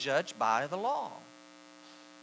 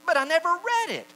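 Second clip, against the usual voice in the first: high, loud excuse; raised-pitch protest; protesting objector; 14 semitones higher, 7 dB louder than usual. Acted. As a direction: blurt a mock objection. Voices an imagined objector.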